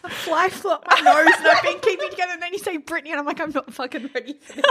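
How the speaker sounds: breaking up into high giggles mid-sentence; laughing. Real, not acted. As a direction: talk through a fit of laughter. Fly up her nose, giggling.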